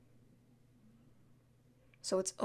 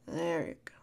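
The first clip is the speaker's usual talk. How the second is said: soft, lower whisper; whispered soothing; 5 semitones lower, 5 dB quieter than usual. Pretend. Whispering comfort to a crying friend. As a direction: murmur it gently.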